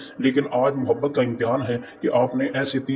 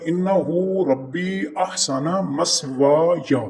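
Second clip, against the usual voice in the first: slow blending; 3 semitones higher, much slower than usual. Shown as a demonstration. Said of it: "ये सारा लफ्ज़ क्या बनेगा".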